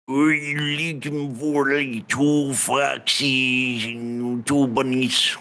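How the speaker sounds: put-on thick rustic accent with stretched vowels; accented local. Local asks a traveller about bandits, rustic accent. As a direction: play a folksy old-country local. Voices a non-player character.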